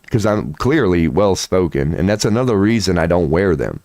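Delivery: usual speaking voice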